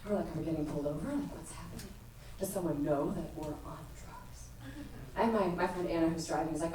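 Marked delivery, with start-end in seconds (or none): 0.3-4.7 hushed paranoid voice. Retold or imitated. High friends fear police.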